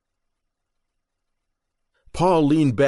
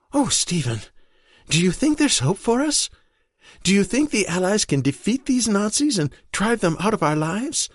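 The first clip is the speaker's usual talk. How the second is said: higher, pleading wife close to tears; Marion, desperate wife; 10 semitones higher than usual. Acted. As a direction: beg him for a shred of hope. Marion clings to husband, desperate.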